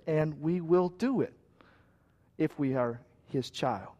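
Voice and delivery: a hushed pastoral close; quiet preaching. Real, not acted. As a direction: draw the room in close and quiet. Quiet, slow, intimate preaching.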